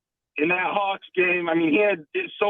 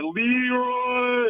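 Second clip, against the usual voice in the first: higher, drawn-out "Leroy" call; Leroy call on request; 5 semitones higher than usual. Shown as a demonstration. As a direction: stretch the name out long as a show catchphrase. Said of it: "give me a Leroy".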